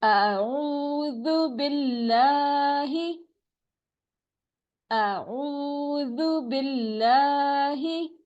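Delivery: slow, stretched recitation; measured tajweed chant; Quranic recitation. Shown as a demonstration. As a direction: recite slowly with long vowels. Practice recitation.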